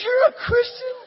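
very loud, high mock shriek of disbelief; hammed-up shrieking amazement; incredulous shriek. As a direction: shout it as a stunned, tearful woman. Repeats her disbelief, much louder.

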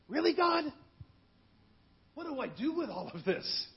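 high, disbelieving Really God; pleading with God in overwhelmed disbelief; exasperated plea. Retold or imitated. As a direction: throw the question up to God in disbelief.

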